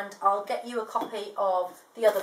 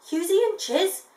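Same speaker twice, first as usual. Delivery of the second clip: disbelieving; 9 semitones higher, 5 dB louder than usual. A narrator's reading. Sheila repeats in disbelief.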